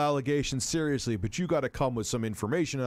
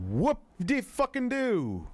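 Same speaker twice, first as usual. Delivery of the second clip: drawn-out, high-pitched sarcastic whoop; sarcastic mock excitement; 11 semitones higher, slower than usual. Real, not acted. Streamer scoffs at news of a celebrity turning Wiccan, sarcastically. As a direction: stretch it high and slow, feigning huge excitement.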